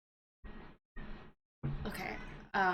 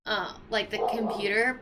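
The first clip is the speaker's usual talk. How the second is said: normal talking voice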